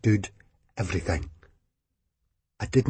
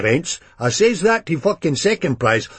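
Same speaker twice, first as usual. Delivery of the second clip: raised, rough Edinburgh hard man; 7 semitones higher than usual. A narrator's reading. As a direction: play the hard man, half shouting. Begbie voiced in the story.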